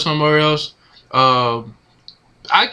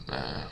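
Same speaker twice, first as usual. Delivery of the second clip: long, soft sigh into a low "man"; heavy sigh; 14 semitones lower, 18 dB quieter than usual. Real, not acted. Fan mourning a veteran's release, heavy sigh.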